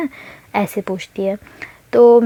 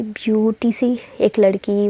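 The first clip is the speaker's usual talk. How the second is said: ordinary delivery, nothing marked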